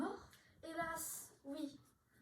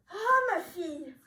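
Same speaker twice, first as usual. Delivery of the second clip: a loud slow wail of grief; anguished cry; 3 semitones higher, 13 dB louder, slower than usual. Acted. Father over his daughter feigning death, loud grieving wail. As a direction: cry out over the fallen child.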